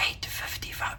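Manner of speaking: hushed, breathy whisper. Retold or imitated. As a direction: whisper it like a secret threat. Start lady's mock-threat retold.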